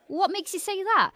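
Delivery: high-pitched querying teacher. Acted. Teacher querying the kid's answer, high-pitched question. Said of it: "And the teacher says".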